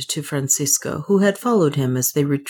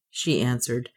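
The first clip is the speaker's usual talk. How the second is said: usual speaking voice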